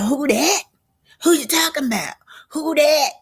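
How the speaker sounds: high-pitched repeated challenge; insistent questioning. Acted. Destiny demanding who is meant, high and insistent. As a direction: keep pressing, voice pitched high. Destiny presses Tisha for the name.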